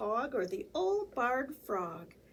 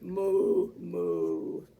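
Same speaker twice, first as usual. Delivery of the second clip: muttered moo; 4 semitones lower than usual. Acted. Confused cow muttering at the sneeze, low moo. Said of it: "who muttered".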